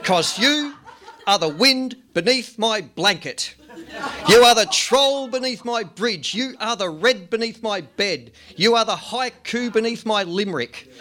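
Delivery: lively rhythmic poem reading; poem performance. Acted. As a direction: perform the list poem with punch.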